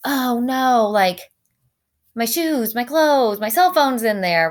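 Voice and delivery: lightly hammed-up wail of dismay; mock dismay. Teacher imagining a first reaction to a house fire, mock dismay. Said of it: "our first reaction might be like".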